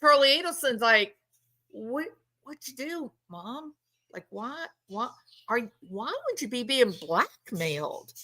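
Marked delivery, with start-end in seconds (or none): 1.7-8.1 very soft, stammered mock bewilderment; hushed imitation of confused disbelief; baffled son. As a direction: falter quietly, as if stunned. Acts out baffled son to mother.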